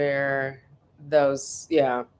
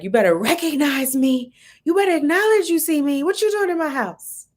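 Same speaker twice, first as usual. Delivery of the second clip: much higher, louder, sassy mock voice; baby barking at TV dog; 10 semitones higher, 5 dB louder than usual. Voicing the baby barking at Clifford on TV, sassy. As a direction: voice the baby's tough talk. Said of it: "like, barking".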